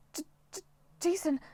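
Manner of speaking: breathless, stuttered name; stammering. Acted. Piper stammering after their fall stops. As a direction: barely get the name out in shock. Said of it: "Piper managed".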